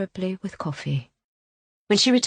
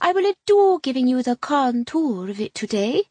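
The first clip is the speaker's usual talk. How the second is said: mock French accent, lightly done; horrid faux French accent; 5 semitones higher, 5 dB louder than usual. A narrator's reading. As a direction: put on a pretentious fake French accent. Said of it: "with a horrid faux French accent".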